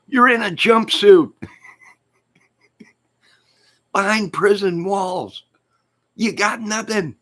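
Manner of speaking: high, loud, hammed-up jeering; mock taunting. Comic mocks Greeno behind prison walls, high jeering. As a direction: taunt him in a squealing put-on voice.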